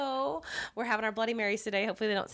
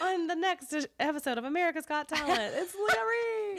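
game show announcer; 9 semitones higher than usual. Pretend. Announcing a talent show act.

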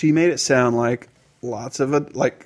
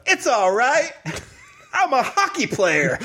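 put-on high little girl voice; high tiny girl voice; 9 semitones higher than usual. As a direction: sound like a proud six-year-old. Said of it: "she's like".

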